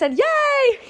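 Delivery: high squeal of delight; gleeful cheer. Real, not acted. You wrap up the tale with a delighted squeal. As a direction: cheer the happy ending. Happy ending of story.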